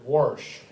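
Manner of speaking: "warsh" with an added r, lightly Texan; a hint of Texas drawl on "warsh"; Texas twang. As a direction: say "warsh" instead of wash, a mild twang. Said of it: "that damn Texas twang".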